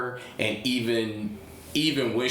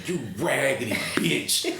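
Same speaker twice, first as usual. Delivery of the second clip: high-pitched heckling at the screen; mock scream of outrage, breaking into laughter; retold screaming; 7 semitones higher than usual. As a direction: yell it like an exasperated moviegoer. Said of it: "I kept screaming".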